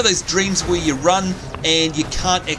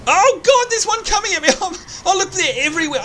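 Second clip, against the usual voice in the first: recoiling as a monster charges; startled panic; 12 semitones higher than usual. Real, not acted. Yeti charges the player, who yelps high in alarm.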